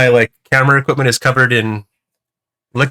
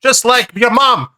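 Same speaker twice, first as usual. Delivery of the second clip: put-on higher old-time salesman, stretching the tag line; old-timey voice; 7 semitones higher than usual. Selling a home soda maker, old-timey pitch. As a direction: pitch it like a vintage radio ad. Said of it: "It's all like old-timey Jim".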